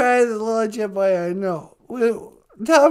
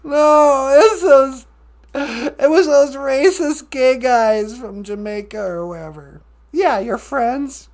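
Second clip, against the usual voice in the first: fake crying; 5 dB louder than usual. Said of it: "Don't cry".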